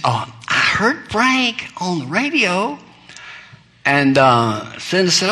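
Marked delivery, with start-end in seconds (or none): 0.0-2.8 lightly voiced prim old lady, higher; a hint of a sweet proper grandmother; softly voiced genteel grandmother. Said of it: "very prim, proper, sweet".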